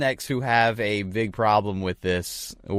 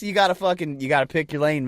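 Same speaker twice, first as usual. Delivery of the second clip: usual speaking voice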